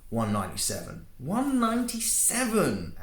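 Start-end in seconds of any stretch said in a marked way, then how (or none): 1.2-3.0 high, slow, overemphasized mockery; mocking. Antoine parroting Male's age back at him mockingly. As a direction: sneer it back, stretching every word. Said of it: "Antoine mocked, overemphasizing words".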